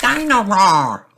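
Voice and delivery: put-on high, slow toddler voice; a tiny boy calling his toy; George's voice. A narrator's reading. George wanting Dino Roar in the bath, high toddler voice. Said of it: "says George".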